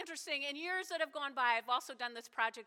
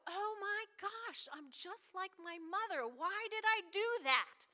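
self-exasperated; 6 semitones higher, slower than usual. Retold or imitated. Exasperated at own behaviour.

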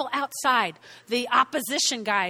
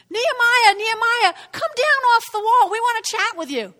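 calling out; 9 semitones higher, 7 dB louder than usual. Acted. Opponents coaxing Nehemiah down off the wall, calling out. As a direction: holler up to lure someone down.

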